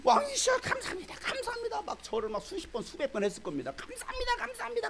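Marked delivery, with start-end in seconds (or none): 0.0-1.8 high, excited gushing thanks; bowing again and again, voice way up; grateful servant. Retold or imitated. Servant gushing thanks to the king, high-pitched and overjoyed. 3.7-4.9 high, excited gushing thanks; bowing again and again, voice way up; grateful servant. Retold or imitated. Servant gushing thanks to the king, high-pitched and overjoyed.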